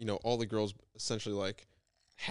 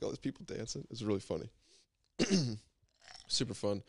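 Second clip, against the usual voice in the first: normal talking voice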